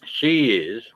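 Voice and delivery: normal talking voice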